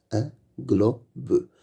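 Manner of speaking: Un globe split into un, glo, be; syllable by syllable. Shown as a demonstration. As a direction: sound out "Un globe" with gaps between syllables. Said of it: "Lis les mots".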